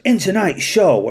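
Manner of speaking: TV trailer voice. Retold or imitated. Officer mimicking the Interceptors trailer, slow mock announcer. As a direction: ham up the show's opening line. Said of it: "the golden ticket is the first".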